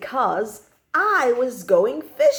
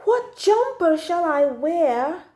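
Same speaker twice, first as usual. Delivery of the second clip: put-on child asking; 6 semitones higher, 4 dB louder, slower than usual. A narrator's reading. Child narrator voices own question.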